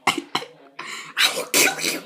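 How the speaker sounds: slow, unvoiced creepy whisper; menacing whisper. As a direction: breathe the threat slowly like a killer. Mock threat after laugh.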